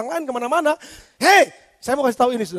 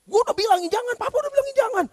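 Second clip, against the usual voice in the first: exasperated father scolding, voice climbing high; a fed-up dad rising higher as he nags his child; scolding parent; 6 semitones higher than usual. Retold or imitated. Father scolding his child for disobeying, rising to a high exasperated pitch. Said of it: "Tapi sama anak lu gimana?".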